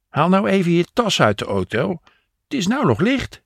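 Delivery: high-pitched coaxing woman's voice; high voice asking a small favour; Jopie requesting. A narrator's reading. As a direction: nudge him along in a high, lilting voice. Jopie sends Henk on errand.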